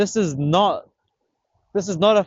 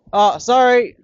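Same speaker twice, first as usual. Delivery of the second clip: raised, higher-pitched apology; calling out; 8 semitones higher, 6 dB louder, slower than usual. Real, not acted. Angler to annoyed bystander, calling out. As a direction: call an apology across the beach.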